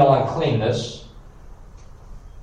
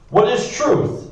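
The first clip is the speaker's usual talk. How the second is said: retorting; 10 semitones higher, slower than usual. A narrator's reading. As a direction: throw the question back scornfully. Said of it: "retorted Pilate".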